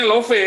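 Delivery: love read as two syllables, lo-fe; loud, high "love" with the final e sounded; Indonesian spelling pronunciation. Shown as a demonstration. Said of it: "Orang Indonesia bacanya love".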